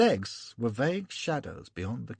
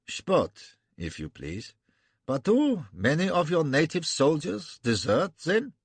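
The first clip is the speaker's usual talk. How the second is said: lightly lisped German princeling; 6 semitones higher than usual. Acted. German prince answering the generals, light lisp. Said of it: "pimpish, affected lisp".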